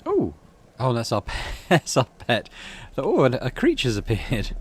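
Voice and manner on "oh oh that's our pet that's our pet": surprised. Real, not acted. Spots the pet.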